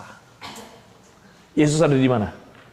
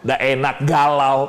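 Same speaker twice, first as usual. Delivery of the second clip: ordinary delivery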